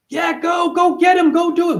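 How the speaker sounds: high, loud cheering of "go get him"; mock cheering. A supporter rooting for you, high loud cheer. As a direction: cheer someone on like a fan.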